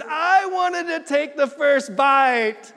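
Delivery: put-on loud, high, drawn-out kid wail; hammed-up six-year-old meltdown; mock bawling child. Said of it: "she just was bawling".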